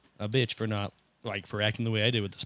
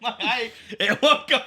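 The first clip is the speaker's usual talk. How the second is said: laughing; 10 semitones higher, 4 dB louder, slower than usual. Real, not acted. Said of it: "My eye's twitching".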